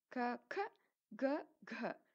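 க said in turn as ka, kha, ga, gha; four k sounds. Shown as a demonstration. Teacher listing the four letters, each க distinctly sounded. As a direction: step க from plain to breathy and voiced. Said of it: "அப்படி சொல்றோம்".

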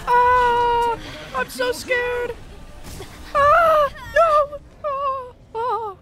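high, loud frightened wails; panicked cries. Real, not acted. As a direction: cry out in real panic. Panicking as enemies attack.

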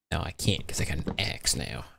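accidental Southern twang, low and soft; Southern accent. Real, not acted. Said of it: "Put on a southern accent".

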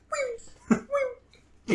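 very high, long "wee" squeal like a thrill ride; imitated joyful squeal. Friend retells body surfer's GoPro cry, very high squeal. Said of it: "'cause it's just like".

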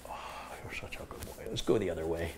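whispered tender pet talk. Real, not acted. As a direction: hush to the dog. Whispering to dog.

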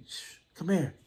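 softly calling someone over, shushing; quiet come-here; hushed beckon. Retold or imitated. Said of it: "sit back like".

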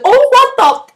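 high, loud cry of alarm; mock-startled outburst; startled yelp. Startled reaction to bubbling water.

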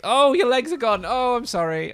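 loud startled apology; shocked yell. Real, not acted. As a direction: blurt it out in shock. Reacts to gore, mock apology.

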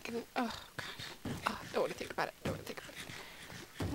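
soft, fading voice of regret; sad near-whisper. Real, not acted. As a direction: trail down to a breath, avoiding the memory. Shies from sad pet memory.

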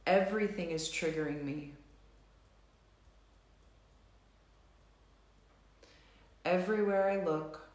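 slow, measured setup phrases with long pauses; tapping script read. Acted. Tapping leader reads the setup statements to the group, slow and measured. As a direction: guide the group through each tapping statement.

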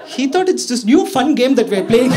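ordinary talk

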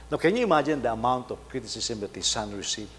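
usual speaking voice

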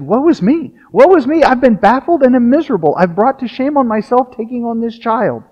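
high, hammed-up wail of woe; mock lament. Defeated teacher wails to the crowd in a high mock lament. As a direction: play the ruined teacher, over the top.

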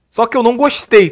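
ordinary talk, nothing marked

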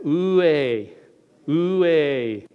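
"oué" like a Greek cry of alarm, oo-eh. Shown as a demonstration. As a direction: say "oué" as two syllables, oo-EH. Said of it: "Say that".

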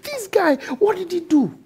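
mock outrage at the attacker; outraged. Angry husband quote.

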